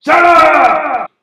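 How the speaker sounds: very loud, high screeching crow; crow shout. Acted. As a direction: snap at them like a bossy crow. Crow scolding.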